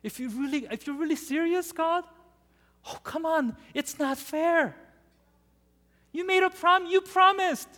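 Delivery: put-on high, pleading child's whine; desperate whining. Moses whining to God like a child. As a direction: beg like a kid who was promised. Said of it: "Moses is almost getting now desperate".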